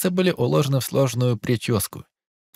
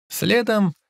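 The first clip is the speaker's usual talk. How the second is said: plain reading voice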